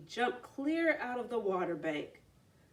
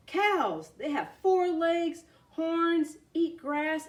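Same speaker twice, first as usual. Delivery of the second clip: excited; 8 semitones higher, 5 dB louder, slower than usual. A narrator's reading. Frog excitedly describing world.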